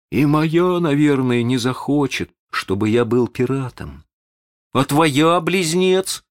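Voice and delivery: high, mildly mock-sorrowful child; feigned contrite regret. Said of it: "тот тут же сокрушённо произнёс".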